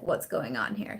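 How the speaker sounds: low, grumpy dog voice; dog's voice. Retold or imitated. Voicing her dog wondering what's going on, low. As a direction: voice the dog's gruff confusion. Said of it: "So she's like".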